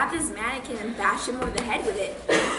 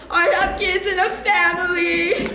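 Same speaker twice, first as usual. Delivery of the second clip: mock crying plea; 6 semitones higher, 7 dB louder than usual. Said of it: "was crying or whatever".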